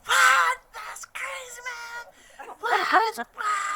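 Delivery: very high squeaky repeated what, hammed up; freaking out. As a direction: lose it completely in amazed disbelief. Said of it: "freaking out".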